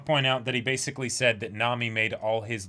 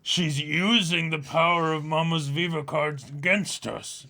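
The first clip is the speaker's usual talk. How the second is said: slow, higher put-on villain; cartoon villain voice; 4 semitones higher, 4 dB louder, slower than usual. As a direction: growl out a hammed-up villain. Dubbing Cracker character lines.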